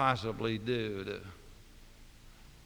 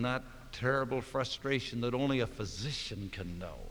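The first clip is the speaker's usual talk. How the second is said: usual speaking voice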